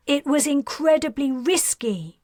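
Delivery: anxious mother exclaiming. A narrator's reading. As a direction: burst out in worried alarm. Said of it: "Talon exclaimed".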